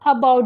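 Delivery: "about" with a weak uh at the start; schwa in about. Shown as a demonstration. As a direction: say "about" as uh-BOUT, first vowel light. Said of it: "यही होता है स्व sound यानी कि वीक sound".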